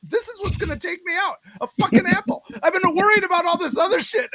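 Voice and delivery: high, choking disbelief; panicked disbelief. Retold or imitated. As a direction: spit it out in panic. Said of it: "sitting there like".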